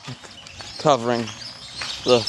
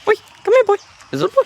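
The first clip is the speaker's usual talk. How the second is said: calling an animal in a squeaky high voice, louder; high pet-talk voice; 5 dB louder, much faster than usual. Real, not acted. Talking to a zoo animal: high, louder pet voice. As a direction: coax the animal like talking to a puppy.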